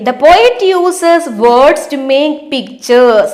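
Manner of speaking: reading the question out very slowly; slow, deliberate classroom read-aloud; slow textbook reading. Acted. Teacher reads textbook sentence.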